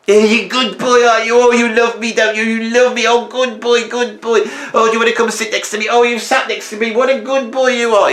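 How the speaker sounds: put-on high, gushing baby talk to a dog; mocking doting dog owner. Mocking a dog lover cooing at their pet, high and gushing. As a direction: sneer at soppy dog people by overdoing it. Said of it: "all that rubbish".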